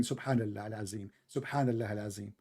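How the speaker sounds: low, soft mock chant of the caller; mocking imitation. Mocking the caller's reply, low soft parody chant. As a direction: murmur it back as a sneering parody. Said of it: "so you start screaming".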